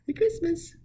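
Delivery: very high, loud falsetto greeting; exaggerated high-pitched imitation of a friend's cheery farewell; Marsha impression. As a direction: sing it out in a squeaky falsetto. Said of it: "And she'd go".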